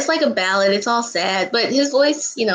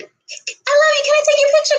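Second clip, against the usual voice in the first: gushing fan; 3 dB louder than usual. Pretend. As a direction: play the overeager fan, way up high. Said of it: "I wouldn't wanna be like".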